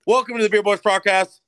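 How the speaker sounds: loud, high hype podcast open; hype announcer intro. Acted. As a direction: announce it big like a show host.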